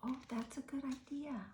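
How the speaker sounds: hushed storyteller aside; whisper. A narrator's reading. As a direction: whisper it to the children. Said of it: "whispered aside to listener".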